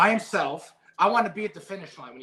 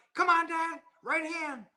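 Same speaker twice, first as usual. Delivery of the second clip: put-on much higher child's voice calling from the corner; imitating his young son; 12 semitones higher than usual. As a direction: pitch way up like a ten-year-old kid yelling advice. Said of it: "you can hear his voice, his loud voice".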